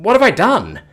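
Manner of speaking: put-on a shaken young man spiralling into dread; horrified he killed his friend; panicked. Preston panics after the punch.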